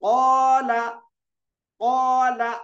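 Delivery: कौला with a loud, held, rounded "qaw"; full heavy alif. Shown as a demonstration. Teacher modelling recitation, कौला long and heavy. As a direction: raise and sustain the thick vowel in कौला. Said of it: "इस तरह पढ़ेंगे इसको".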